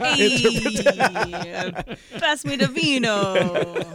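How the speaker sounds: hammed-up Jersey accent, laughing; quick jokey Jersey accent. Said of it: "your best Jersey accent".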